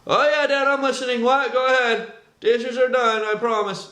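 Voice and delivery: fob dad off like a distracted teenager; dismissive modern kid. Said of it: "Modern day, it would look like this".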